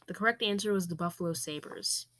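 ordinary talk, nothing marked

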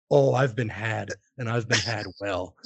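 rueful realisation. Retold or imitated. Retells realising he was pranked.

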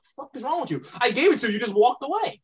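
high, exasperated mock retort; retelling an angry snap at a rude customer, high and incredulous. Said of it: "I was like".

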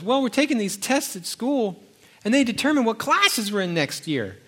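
a hint of a worried kid; son's voice. Son explaining school tests, lightly voiced boy.

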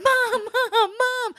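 high, loud, panicky wail for mom; frightened calling. Retold or imitated. Two scared girls calling their mother, high panicked wail. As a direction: cry out for help like scared kids.

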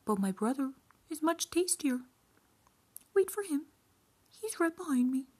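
high-pitched goat pleading its case; middle goat. A narrator's reading. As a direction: talk your way out in a high goat voice. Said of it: "so he said to the troll".

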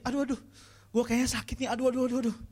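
milk a tiny fever for drama; hammed-up sick moaning. Youth moaning about slight fever.

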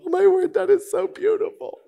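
very high mock-moved voice; hammed-up high-pitched gushing; gushing moviegoer. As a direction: squeak it out as an overcome viewer.

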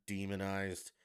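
usual speaking voice

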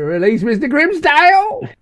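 high, loud comic yell; mock shouted order. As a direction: yell it out as a silly command.

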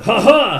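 high, loud mock cackle; villainous laugh. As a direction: gloat with a hammed-up "ha ha". Villain Wong gloats.

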